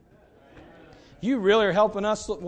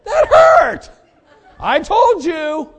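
very high, very loud laughing yelp of pain; hammed-up outrage at the burn; mock pained shout; 16 dB louder, much slower than usual. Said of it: "I was like".

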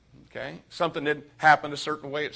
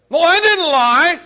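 high, loud, slow mocking whine; sarcastic teen whine defending himself; whiny excuse; 12 semitones higher, 13 dB louder than usual. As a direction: mimic a kid protesting his innocence. Mimicking a defensive kid.

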